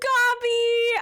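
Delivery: high, loud delighted cry; excited call. Real, not acted. Streamer greeting her pet in game, excited shout. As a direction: call the pet's name with joy.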